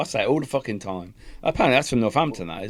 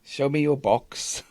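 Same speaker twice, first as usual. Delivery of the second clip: slow, raised, hammed-up West Country voice; mock Cornish teacher; 4 semitones higher, slower than usual. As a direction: mimic a Cornish sailor, drawing out the words. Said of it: "I'm gonna have to look at your books".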